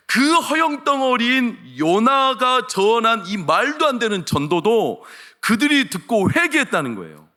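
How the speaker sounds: slow, high, loud pulpit delivery; forceful, drawn-out sermon emphasis; emphatic preaching. Real, not acted. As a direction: stretch the words out and drive the point home. Preacher drives the point home.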